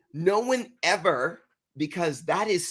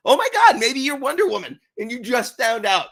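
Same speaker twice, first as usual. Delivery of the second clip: imitating wild amazement, much higher and louder; mock amazed exclamation; 7 semitones higher, 6 dB louder than usual. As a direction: gush as if she's a superhero. Retold excited exclamation.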